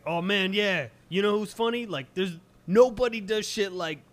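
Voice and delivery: high, loud eager fan voice; hammy stage actor. Retold or imitated. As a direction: gush like an old comedy fan. Imitates nostalgic comedy fan.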